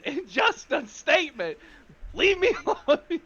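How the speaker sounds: high-pitched plea to be left alone; defensive protest. Acted. Confused character defends himself.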